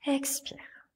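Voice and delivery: airy whisper to lead the breath out; whispered exhale cue. Real, not acted. (expiration chuchotée dans un souffle)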